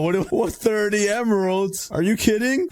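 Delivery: high stunned double take; stunned, almost squeaking; disbelief. Real, not acted. Gamer reacting to thirty emeralds, high disbelief.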